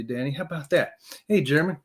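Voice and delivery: ordinary talk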